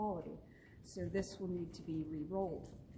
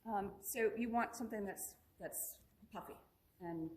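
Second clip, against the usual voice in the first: ordinary talk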